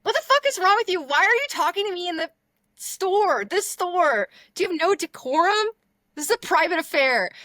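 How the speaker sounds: high, loud mock outrage; shrieking offended tirade; indignant outburst. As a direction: scold in scandalized disbelief, at full pitch. Acted outrage at the store worker.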